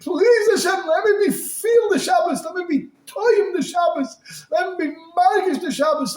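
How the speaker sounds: high, impassioned plea; pleading prayer cried out; fervent begging. Acted. Acted begging prayer to feel Shabbos.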